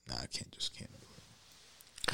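breathy near-whisper, low and slow; hushed refusal. Retold or imitated. Retelling his breakup, whispering his refusal. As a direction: whisper the no like you're drained. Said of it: "but it was like".

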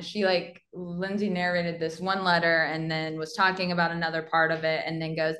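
ordinary talk

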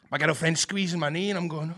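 ordinary delivery

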